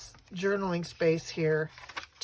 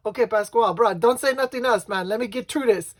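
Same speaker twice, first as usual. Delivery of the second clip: loud, higher-pitched exasperated plea, retold; Hawaiian priest; 4 semitones higher, 10 dB louder than usual. Priest pleading with the groom mid-vows, loud imitated plea. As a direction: imitate a laughing-weary priest begging the groom. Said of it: "finally he goes".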